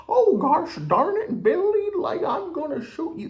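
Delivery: mocking the stoner's dad in a fake country accent. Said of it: "he's got this fucking funky accent".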